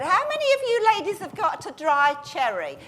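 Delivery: high-pitched art teacher calling to a class. Retold or imitated. Art teacher addresses ladies on a cruise, high put-on voice. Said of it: "there was an art teacher on a cruise".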